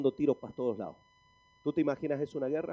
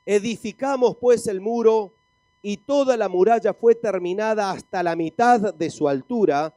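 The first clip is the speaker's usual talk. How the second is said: loud, ringing scripture reading; forceful preaching; 4 semitones higher, 9 dB louder than usual. Acted. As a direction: read the verse out to rouse the congregation. Reads scripture to congregation.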